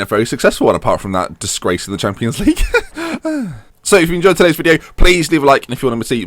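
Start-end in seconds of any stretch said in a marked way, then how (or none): 2.6-3.6 high laugh. Real, not acted. After the Champions League joke.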